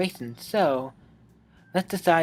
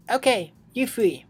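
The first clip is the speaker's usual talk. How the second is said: higher, brighter retake of Monica; 7 semitones higher than usual. Acted. Monica calls the club back to the group. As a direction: lift it up into Monica's voice. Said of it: "I can't remember what her voice was".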